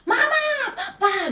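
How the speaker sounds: put-on high, loud, drawn-out child's scream; child screaming for parents. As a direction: cry out for mom and dad in a panic. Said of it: "terus anak saya teriak".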